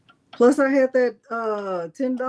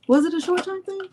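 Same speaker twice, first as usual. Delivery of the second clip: mock questioning voice; 8 semitones higher than usual. Rereading viewer's question in put-on voice.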